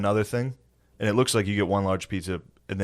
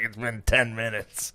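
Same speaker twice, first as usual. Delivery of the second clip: slow whiny voice; 3 semitones higher, 4 dB quieter, slower than usual. Retold or imitated. Retold drunk impatience.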